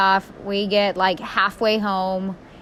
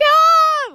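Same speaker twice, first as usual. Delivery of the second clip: short, much higher, louder mock shriek; imitated freak-out scream; 7 dB louder than usual. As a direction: burst out in a panicked yelp. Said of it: "I kinda freak out".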